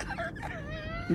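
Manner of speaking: high burst of laughter; shrieking laugh. Acted. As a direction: burst out in a shrill cackle.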